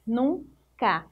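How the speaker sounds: "nunca" as two parts, nun, pause, ca; split into syllables. Shown as a demonstration. Teacher showing pupils the syllables of "nunca", split apart. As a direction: break "nunca" after "nun" with a clear gap. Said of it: "Separando as sílabas".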